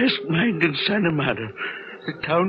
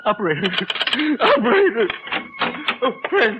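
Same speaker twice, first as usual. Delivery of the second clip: high, loud frantic calls; desperate yelling; 11 semitones higher, 6 dB louder, faster than usual. Acted. Old man shouting for his stepson. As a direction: cry out for help, panicked. Calling operator then son.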